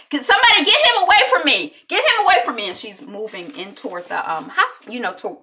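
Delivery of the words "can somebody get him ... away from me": high, loud, fast yell; panicked shout. Retold or imitated. Wife demanding the doctor be taken away, shouting frantically. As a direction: shout for help in acted panic.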